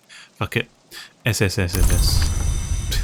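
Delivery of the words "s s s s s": long slow hissing s sounds; drawn-out s like a snake. Shown as a demonstration. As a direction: hiss the s out like a snake. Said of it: "I'll sound like a whistle".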